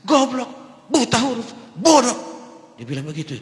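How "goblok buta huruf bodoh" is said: loud, high-pitched cursing; angry insults. Retold or imitated. Drunk man curses the pastor, loud and furious. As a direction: spit the insults at him. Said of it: "Dia marah-marah sama saya".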